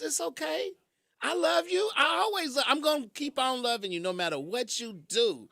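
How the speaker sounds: lightly voiced loving God reassuring. God reassuring a sorry sinner, high loving voice.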